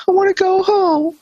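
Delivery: tiny, very high crybaby voice; hammed-up high-pitched whine; mock whimpering. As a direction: imitate a tearful man in a squeak. Voices crying Jared, then laughs.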